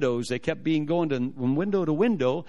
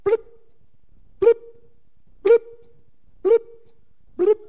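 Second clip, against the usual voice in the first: short, high, loud beeps; imitated counter blips; 8 dB louder than usual. Number display ticking down in the visa office, high mimicked blips. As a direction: pop out evenly spaced electronic blips. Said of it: "it goes blip".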